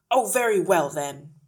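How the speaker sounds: loud, grudging snap; snapped. A narrator's reading. Father gives in to the teacher, snapping. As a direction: give in irritably, hitting the first words loud. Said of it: "he snapped".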